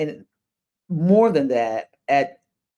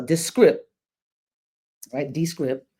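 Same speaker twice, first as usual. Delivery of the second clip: ordinary delivery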